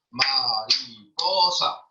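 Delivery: "Mariposa" said very slowly, syllable by syllable; syllable-split word. Shown as a demonstration. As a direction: break "Mariposa" into its syllables, slow. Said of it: "separar las palabras en pedacitos".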